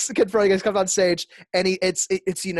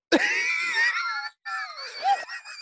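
high cackling villain laugh; a shrieking mad villain cackle; evil laugh; 5 dB quieter than usual. Pretend. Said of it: "That's my evil laugh".

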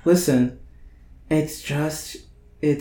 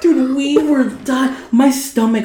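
excited; 11 semitones higher than usual. Real, not acted. Recalling hilarious moment.

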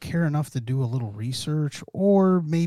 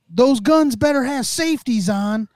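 raised, higher warning voice; retold warning; 9 semitones higher, 4 dB louder than usual. Filmer warning the passing cops, retold loud warning. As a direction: retell it as a loud demand.

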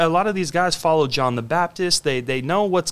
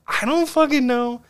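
higher, exasperated blurt; healed blind man; 8 semitones higher than usual. Retold or imitated. Healed man answering the Pharisees, higher exasperated voice. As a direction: pitch up, shrug it off in exasperation. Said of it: "And he goes".

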